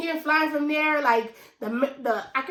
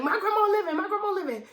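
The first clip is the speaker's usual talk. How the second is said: high, pleading denial through tears; begging it not to be true; crying plea; 7 semitones higher, faster than usual. Retold or imitated. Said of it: "I started screaming and I was crying".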